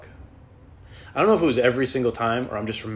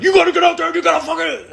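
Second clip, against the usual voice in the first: much higher, louder roaring drill-sergeant yell; David Goggins impression; 11 dB louder than usual. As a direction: bellow it like a fanatical fitness coach. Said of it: "He's like".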